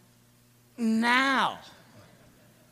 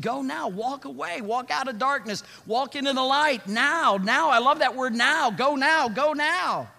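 higher, louder urging call; fervent preaching; 6 semitones higher, 5 dB louder than usual. Acted. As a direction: push them out of darkness. Preaching at full force.

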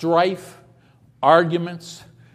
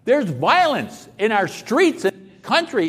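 ordinary talk

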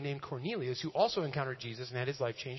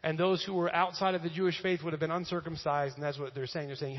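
ordinary delivery

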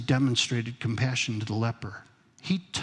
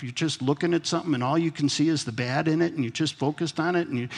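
ordinary talk, nothing marked

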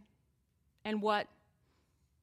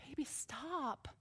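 put-on softer, tender "baby, stop"; gentle urging; 2 semitones higher, 10 dB quieter, slower than usual. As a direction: hush it, as if soothing someone. God gently telling listeners to stop.

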